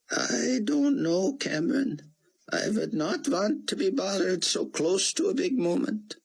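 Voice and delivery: put-on high, foreign-sounding older gentleman; old Israeli scholar. Rosenzweig voiced by the narrator.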